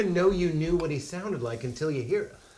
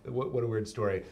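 ordinary talk, nothing marked

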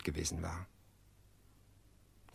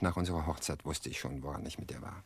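bitter whisper; 3 semitones lower, 7 dB quieter than usual. Acted. Man recalls wife's infidelity.

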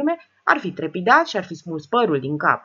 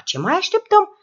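higher, urgent alarmed voice; 12 semitones higher, 7 dB louder than usual. Acted. Sile urges calling the police, alarmed and high. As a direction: push to call the police in a panic. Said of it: "început să se alarmeze".

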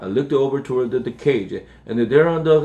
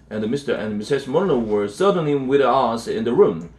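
usual speaking voice

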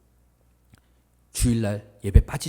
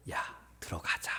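soft, hushed coaxing; gentle persuasion; 12 dB quieter than usual. Acted. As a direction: coax a sulking son inside very softly. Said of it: "설득을 해요". (조용히 달래듯이)

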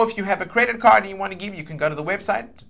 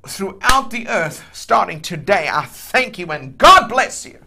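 impassioned preacher praying over a giver; fervent prayer; 3 semitones higher, 7 dB louder than usual. Real, not acted. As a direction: pray with full conviction, surging on key words. Prays aloud for a giver.

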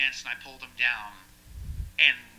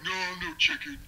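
put-on much higher slurred drunk bravado; drunk guy; 12 semitones higher than usual. Drunk guy refusing to chicken out, high mock drunk voice. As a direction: play a drunk refusing to back down. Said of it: "they were very drunk at this point".

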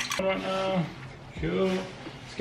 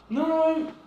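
alarmed no; 5 dB louder than usual. Real, not acted. Sudden mishap.

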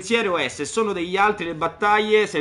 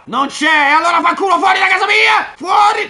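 angry shout; 11 semitones higher, 9 dB louder than usual. Acted. Throwing out imagined accuser.

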